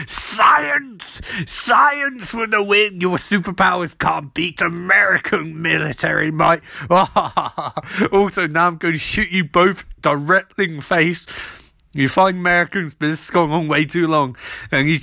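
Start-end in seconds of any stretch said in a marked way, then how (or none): 0.1-9.8 gloating villain. Retold or imitated. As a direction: crow it like a mad general. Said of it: "and Idling's like".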